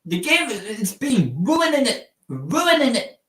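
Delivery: high, far-off mock British "Ruining it" with the t dropped; "Ruining it" sung up high like a Brit; mock British accent. Shown as a demonstration. Streamer mocking British slang, high mock British accent. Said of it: "What a British slang".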